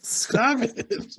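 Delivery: very high mock plea; high-pitched comic protest; retold protest. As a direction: squeak it out, imitating someone grossed out. Retold plea after gross detail.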